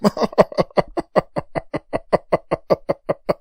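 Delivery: loud, high villain laugh; maniacal cackle. Acted. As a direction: burst out cackling at them. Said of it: "I'm just laughing".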